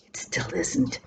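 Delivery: whispered aside, dry and amused; wry whispered aside. Real, not acted. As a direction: drop to a whisper for the joke. Wry aside after "not everyday object.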